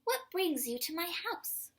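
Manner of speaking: high, bright-eyed question; exclaimed. Acted. Amu greeting a surprise visitor, exclaiming high. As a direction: exclaim it in delight. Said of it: "Amu exclaimed".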